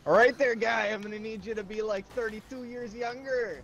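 light mock Minnesota accent; a hint of a Minnesota accent, friendly and folksy. Teasing his friend in a mild Minnesota accent. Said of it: "why are you talking like a New Yorker".

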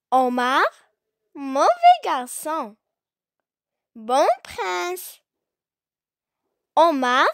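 measured classroom poem reading, punching each name; poem recitation. Acted. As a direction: recite it line by line with strong melodic swings and long pauses.

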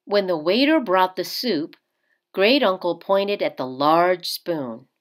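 usual speaking voice

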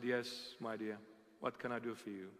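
a priest's soft welcome to a troubled man; gentle reply. Retold or imitated. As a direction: reassure the stranger gently and quietly. Priest gently answers a stranger.

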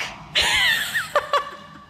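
very high, shrieking burst of laughter; laughing shout. Real, not acted. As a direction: crack up with a high yelp.

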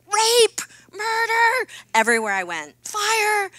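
sudden high shouted words; blurting the worst things. Retold or imitated. At press events, shouting out the worst things. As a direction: yell each shocking word out at full volume. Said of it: "I would just say the worst things".

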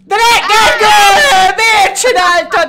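slow, high alarmed shout; drawn-out dismayed yell. Real, not acted. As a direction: yell at Gergő to stop. Friends gaming, chaos with Gergő.

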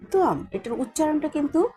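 usual speaking voice

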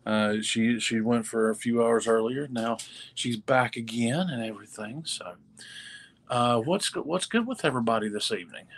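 ordinary delivery

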